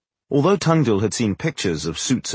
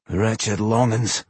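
slow, cranky dwarf grumble; cranky mutter; much slower than usual. A narrator's reading. Dwarf mutters about his enemies, cranky. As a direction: mutter it slowly, thoroughly fed up. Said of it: "Boendal muttered crankily".

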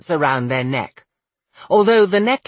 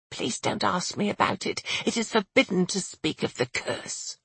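much softer, higher, anxious voice; hushed plea; 4 semitones higher, 9 dB quieter than usual. A narrator's reading. Maid begs not to be asked, hushed and fearful. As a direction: beg in a near whisper.

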